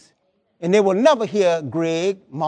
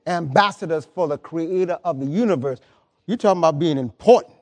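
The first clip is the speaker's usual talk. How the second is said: usual speaking voice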